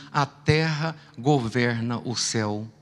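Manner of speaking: slow, low, quiet divine voice; voice of God. Retold or imitated. Preacher voicing God answering him, slow and hushed. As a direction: speak it slow and hushed like a voice from above. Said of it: "Deus disse pra mim assim".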